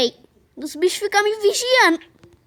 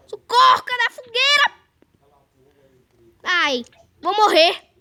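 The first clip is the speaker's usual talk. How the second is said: panicking gamer. Real, not acted. Gamer chased, near death.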